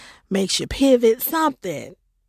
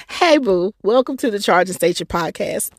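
usual speaking voice

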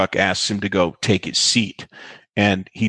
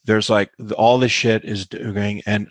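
usual speaking voice

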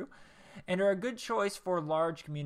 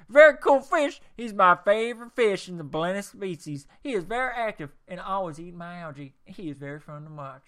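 put-on Texas accent; 9 dB louder than usual. Reading Joseph from Waco's review in a Texas accent. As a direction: open with a big Texas holler, then ease down. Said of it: "I got one more Texas one".

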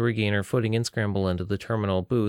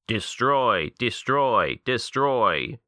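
robot yell; 3 semitones higher, much slower than usual. Acted. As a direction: pound out each word like a machine. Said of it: "a voice yelled".

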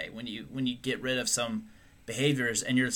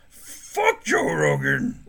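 low slow growl; 11 semitones lower, 3 dB louder, much slower than usual. Pretend. Mock contempt for Rogan.

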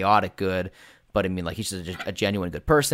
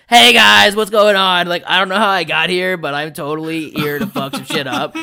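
loud, high dumb-guy voice; K-Dog; 9 semitones higher, 10 dB louder than usual. Retold or imitated. Game master imitating the knocked-silly personality, dumb and hollering. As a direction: burst in as a clueless party dude, easing toward the end. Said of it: "the dumb personality".

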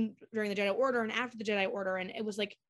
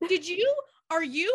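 high, louder excited questions; mock eager questions; 9 semitones higher, 6 dB louder than usual. Ashley fangirling, high excited questions. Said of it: "She goes".

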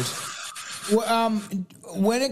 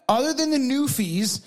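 ordinary talk

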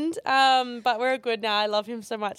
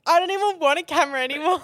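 reliving the moment, voice cracking into tears; tearful outburst; 6 semitones higher, 6 dB louder than usual. Retold or imitated. To friend, retelling throwing the camera, tearful wail. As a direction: cry it out, higher and louder, half laughing at yourself.

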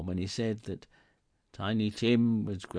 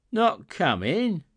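dismayed; 11 semitones higher, 9 dB louder, slower than usual. A narrator's reading. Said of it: "sudden declension of his high spirits".

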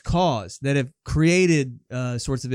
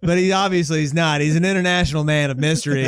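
ordinary talk

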